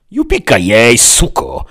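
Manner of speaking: loud, full-force war cry; battle shout. A narrator's reading. Hero swinging a shovel at the vampire, shouting. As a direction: yell it while swinging a weapon. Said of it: "krzyknął i zamachnął się szpadlem".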